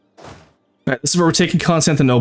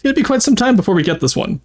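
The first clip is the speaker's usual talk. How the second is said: cute anime streamer; 8 semitones higher than usual. Acted. VTuber voice explaining challenge.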